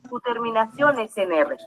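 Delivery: usual speaking voice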